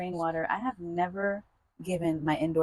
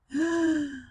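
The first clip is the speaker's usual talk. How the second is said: gasp; 9 semitones higher than usual. Real, not acted. Reacts to water.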